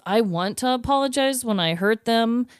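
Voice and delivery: usual speaking voice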